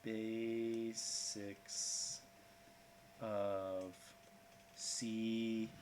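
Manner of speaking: slow, drawn-out codec call; lightly voiced radio handler, very slow. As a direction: stretch each word like a radio transmission. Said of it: "folks on the radio going".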